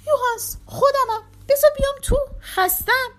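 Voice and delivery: high, loud, urgent begging; pleading. A narrator's reading. Exhausted mistress to her servant at the door, pleading. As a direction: beg to be let inside. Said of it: "ریشموت با التماس گفت".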